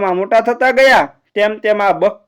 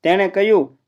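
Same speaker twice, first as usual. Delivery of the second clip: ordinary delivery, nothing marked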